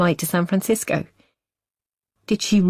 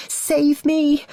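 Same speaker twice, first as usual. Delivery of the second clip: high desperate scream for help; 11 semitones higher than usual. A narrator's reading. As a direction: shriek it out, pleading to be saved. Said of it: "she would scream".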